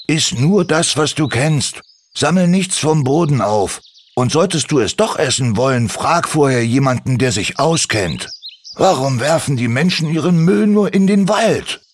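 leicht strenge, mahnende Stimme; streng. Acted. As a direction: eindringlich ermahnen. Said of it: "sagte Andi mit strenger Stimme". (Andi ermahnt die Drossel, leicht streng)

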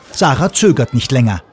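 ordinary delivery

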